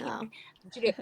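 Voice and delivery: breathy whisper, barely voiced; whispered aside. Telling friends about her grandmother's sayings, whispered aside. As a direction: whisper it like a secret marvel. Quoting herself, hushed request.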